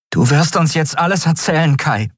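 erhöhte Stimme, fordernd; hartes Verhör. A narrator's reading. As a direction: setz den Gefangenen unter Druck. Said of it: "hasste sich selbst für ihre Grausamkeit". (streng drohend)

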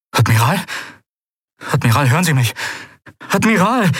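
worried question building to a high cry; growing alarm. A narrator's reading. Kai trying to rouse the motionless admiral, growing alarm. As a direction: start uneasy, climb to alarmed calling.

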